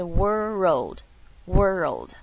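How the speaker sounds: "world" very slowly as wor-rold; slow, two-beat "world", r gliding into o; linked syllables. Shown as a demonstration. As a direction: draw out "world" with 肉 in its middle. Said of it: "所以做出来是".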